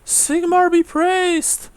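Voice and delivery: put-on very high, loud, drawn-out old woman; elderly woman's high-pitched pious exclamation; exclaiming old woman. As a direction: cry out a high, slow, fervent welcome. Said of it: "She says as she".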